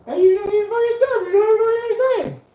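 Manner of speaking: high, loud fake sobbing; mock crying voice. Vlogger mocks imitators with a high fake crying wail. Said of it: "I was like".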